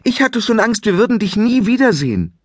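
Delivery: mother releasing her fear; tearful relief. Acted.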